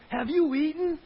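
loud, high accusing shout; angry God. Acted. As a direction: bark it like an angry judge. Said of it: "you can hear".

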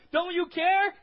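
high, loud panicked shout; acted panic at full volume; terrified cry. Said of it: "They're terrified".

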